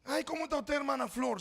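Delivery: high, syrupy hello to a stranger; over-sweet greeting. Retold or imitated. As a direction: pour on the fake warmth. Pastor sweetly greeting unknown lady. (saludo meloso y agudo a la hermana)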